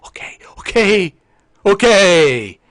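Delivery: from whisper to loud, stretched shout; building to a yell. Acted. Streamer losing his forced calm, building yell. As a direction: let the restraint give way.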